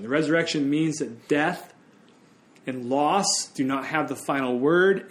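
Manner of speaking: ordinary talk, nothing marked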